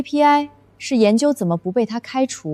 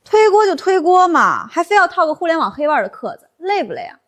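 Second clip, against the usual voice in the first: sarcastic jab; 5 semitones higher, 3 dB louder than usual. Acted. Junior colleague mocking the manager's buzzword excuse. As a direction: needle him for the jargon.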